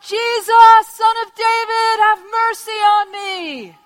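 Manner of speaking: high, full-force sustained cry. Acted. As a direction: bellow a desperate plea over the crowd. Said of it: "He shouted all the louder".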